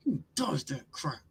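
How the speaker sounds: incredulous whisper. Real, not acted. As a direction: whisper it in disbelief. Mocking long snapper pick.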